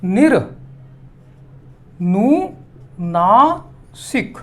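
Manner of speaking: निर-नु-नासिक split into three slow, separate parts; teacher sounding out each syllable. Shown as a demonstration. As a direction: break निर-नु-नासिक apart very slowly for the class. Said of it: "Nira".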